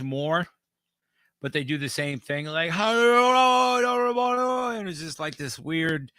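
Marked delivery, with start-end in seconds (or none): 2.7-4.8 high, shouted slurry British greeting; slurry British accent. Retold or imitated. Singer hailing the crowd in slurry British accent. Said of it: "slurry sounding British accent".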